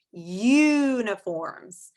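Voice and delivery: "uniforms" slow, first U drawn out; long U sound. Shown as a demonstration. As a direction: stretch the "you" in "uniforms". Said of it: "when I say the word uniform".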